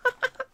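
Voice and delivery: high-pitched burst of laughter; a real squeal of a laugh. Real, not acted. Laughs at his own bit.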